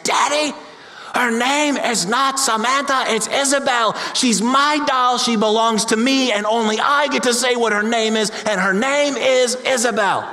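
put-on a five-year-old putting her foot down; little girl shouting. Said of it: "this little girl shouted out".

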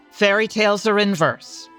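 ordinary talk

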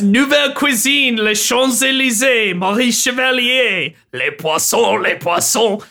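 put-on over-the-top French chef accent; sing-song bad French accent. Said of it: "hilariously bad French accent".

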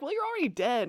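a smug high put-on voice; mock Damon.

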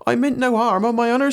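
very high, frightened servant cry; high-pitched fearful plea; quaking. Acted. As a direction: appeal to the master in a high, panicky voice.

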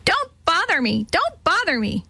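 high, harsh parrot squawk. A narrator's reading. Parrot mimics Betty's answer, in a harsh voice. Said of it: "in a harsh voice".

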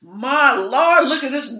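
mock awestruck man; leering admirer impression. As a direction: imitate a man gawking in admiration. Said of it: "That's exactly how he sounded".

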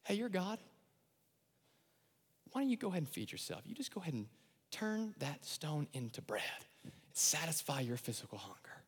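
sly, coaxing devil sliding into a whisper; tempting devil. Acted. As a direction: coax him like a tempter. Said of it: "here's what he says to Jesus".

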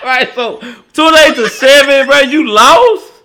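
high, loud, laughing disbelief; mock them through laughter; laughing mockery. Real, not acted. Mocking the score.

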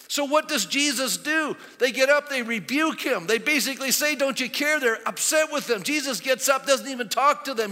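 loud, high-pitched sermon delivery; preaching. Acted. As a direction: preach it out at full force. Preaches at full force.

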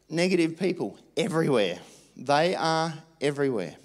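fired-up pulpit exhortation; preaching. Acted. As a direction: lift the congregation, pressing each line home. Pastor exhorts congregation to befriend.

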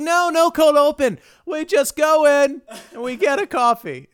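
high, loud, insistent refusal; Jerry Seinfeld. Retold or imitated. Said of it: "Jerry Seinfeld just being like".

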